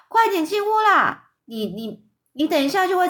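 calling out. A narrator's reading. Woodcutter calls the boy in from outside, urgent high shout. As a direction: call the child indoors, pitch jumping up. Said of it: "就对新孩喊着说".

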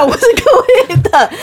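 high, breaking into laughter mid-words; laughing. Real, not acted. Jokes to her co-hosts about her bursting shirt, laughing. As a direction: laugh through a teasing confession.

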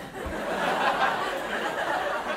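short unvoiced burst of laughter; airy embarrassed chuckle; breathy laugh. Real, not acted. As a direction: laugh at your own blunder. Laughs at own awkward reply.

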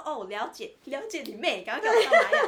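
越说越大声，最后高声笑着吼出; 从嘀咕升到大吼; 气急吼叫. Retold or imitated. 气急败坏地学自己吼牙医. (转述自己对牙医的怒吼，越吼越大声)

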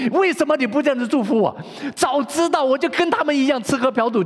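high, loud, aggrieved protest to God; indignant complaint. Acted. 基督徒向神抱怨. (基督徒向神抱怨，高声不满)

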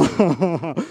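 a short real laugh, rising. Real, not acted. Preacher laughing at the brother's awkward moment, amused chuckle. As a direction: chuckle at your own story.

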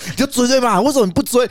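imitating a friend who keeps pushing; pushy friend. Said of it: "然后朋友就说".

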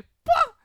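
loud high "peng" splash noise; splash sound effect. Retold or imitated. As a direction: belt out the splash. Soap water splash sound.

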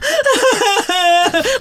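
high mock sobbing howl; exaggerated wail. Shown as a demonstration. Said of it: "我是这种哭".

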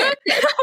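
high giggling laugh; real laugh mid-story; burst of laughter. Real, not acted. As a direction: crack up at yourself. Laughing at her own story.

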